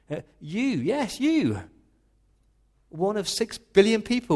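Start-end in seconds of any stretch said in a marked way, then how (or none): 0.1-1.6 "you" pitched high and pointed; single out one listener with "yes, you"; emphatic you. Shown as a demonstration. Preacher pointing at one listener, rising emphatic "you". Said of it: "It's that emphatic you".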